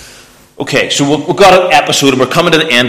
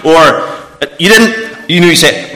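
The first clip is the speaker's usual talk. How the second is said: normal talking voice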